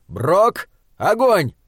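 short order rising to a high shout; shouted command. A narrator's reading. Kearns ordering Brock to light the trench, shouted. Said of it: "Кернс крикнул".

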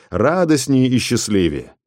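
plain reading voice

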